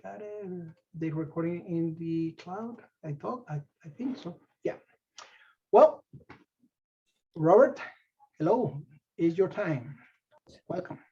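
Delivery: ordinary talk, nothing marked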